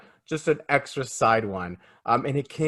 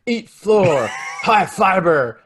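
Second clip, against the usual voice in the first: loud, higher, hammed-up fight one-liners; mock hero taunt; 5 semitones higher, 5 dB louder, much slower than usual. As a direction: shout it like a corny action hero, stretching the end. Mocking Batman's fight one-liners.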